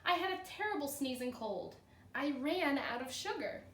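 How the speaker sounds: expressive read-aloud storytelling; cheerful classroom story reading; story narration. A narrator's reading. Teacher reads the story aloud.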